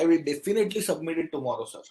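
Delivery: "definitely" pressed hard, said fast; "definitely" given clear emphasis at a fast pace; stress on definitely. Shown as a demonstration. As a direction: push "definitely" to sound sure. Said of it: "He put that emphasis on definitely".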